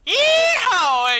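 high, loud cowboy holler; whooping rodeo-style yell; yeehaw shout. Acted. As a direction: holler it out on entrance. Guest makes cowboy entrance.

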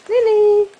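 high, loud call of the name. Real, not acted. Mum calling the puppy Lily, loud high. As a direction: call the puppy across the room.

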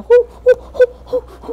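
high, loud pretend boo-hoo wails; cartoon sobbing hoots; mock wailing. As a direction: wail like a hammed-up crybaby. Panicking at own death in game.

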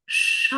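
"Ша" with the vowel drawn out; stretched sound. Shown as a demonstration. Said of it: "промовляємо наступний звук".

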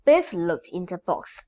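ordinary delivery, nothing marked

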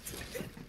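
soft laugh. Real, not acted. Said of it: "Why am I laughing so much".

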